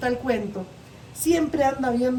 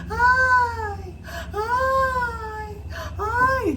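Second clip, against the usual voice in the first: high hammed-up wails of distress; mock wailing cries; 9 semitones higher, much slower than usual. Tío Conejo faking distress again for the whale, high wails. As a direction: fake a cry for help, pitched up. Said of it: "se fue con el mismo cuento".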